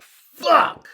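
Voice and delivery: high, loud pained curse; high loud retold swear. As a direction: curse out at the blow. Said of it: "I was like".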